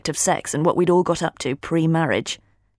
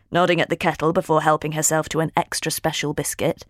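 usual speaking voice

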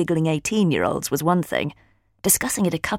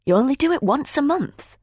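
higher-pitched, puzzled question; concerned disbelief; 9 semitones higher than usual. A narrator's reading. Friend quizzes Holly about her sex life, puzzled. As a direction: ask it with a puzzled lift in pitch. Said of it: "her brow knitting".

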